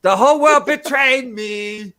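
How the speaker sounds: loud, high, drawn-out mock despair; hammy suicide scene. Host re-enacting a cringy movie suicide, overwrought. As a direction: wail it slowly like a terrible actor. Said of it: "this really cringy suicide".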